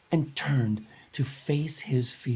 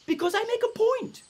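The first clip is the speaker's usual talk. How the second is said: higher, sing-song kindly bat voice; lightly voiced wise flying fox; 9 semitones higher than usual. Flying fox explains her prank to the Ai Ai, kindly. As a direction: teach him a gentle lesson.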